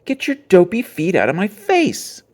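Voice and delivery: loud, high, annoyed yell; angry shout. A narrator's reading. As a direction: yell it at his sister, fed up. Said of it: "he shouted".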